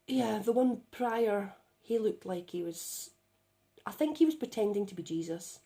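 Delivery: ordinary talk, nothing marked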